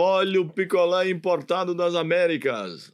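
slow singsong street vendor call; singsong beach vendor call. Retold or imitated. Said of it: "eu falava".

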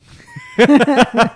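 a sudden loud high burst of laughter; big gleeful laugh; loud high cackle. Real, not acted. Big laugh at own joke.

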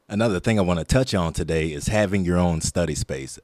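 ordinary talk

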